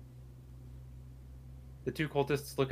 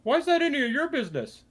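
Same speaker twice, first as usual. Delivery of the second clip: much higher, louder squeaky cultist Jerry; 13 semitones higher, 7 dB louder, faster than usual. Acted. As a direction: pitch Jerry way up, loud and nasal-silly. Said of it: "and he goes".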